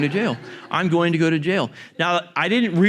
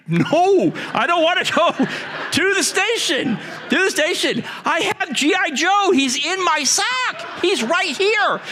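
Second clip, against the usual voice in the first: put-on much higher, squeaky frightened kid begging; panicked kid. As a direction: plead like a terrified little boy. Kid panicking in front of police.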